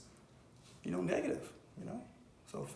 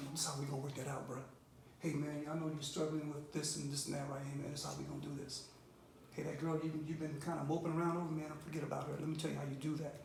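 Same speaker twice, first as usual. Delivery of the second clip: lower, softer man-to-man advice; low-key, confiding reassurance; supportive mentor; 3 semitones lower, 5 dB quieter, faster than usual. Acted. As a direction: take him aside and talk him through it. Imagined mentor offering private guidance.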